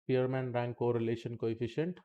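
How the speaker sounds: normal talking voice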